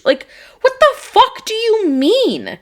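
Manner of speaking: incredulous outrage. Retold or imitated. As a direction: stretch it out in total disbelief. Reliving the argument with the driver.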